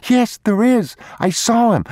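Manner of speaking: put-on high, loud child's voice, urgent; a small boy insisting to his papa; pleading boy. Boy begging his father to believe him, high and loud.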